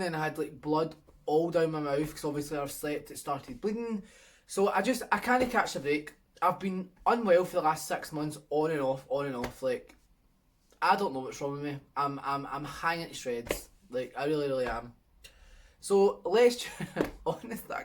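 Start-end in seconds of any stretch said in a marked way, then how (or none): none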